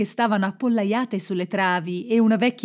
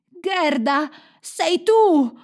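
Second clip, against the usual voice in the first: high, loud delighted cry; elated, building to a shout; joyful recognition; 8 semitones higher, 5 dB louder, much slower than usual. A narrator's reading. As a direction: cry out as you recognise a friend. Kai recognises Gerda with joy.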